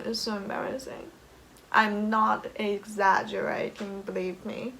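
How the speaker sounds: normal talking voice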